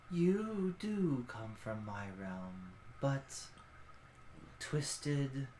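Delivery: hushed ancient guardian; ancient creature voice. Acted. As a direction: linger on twisted quietly.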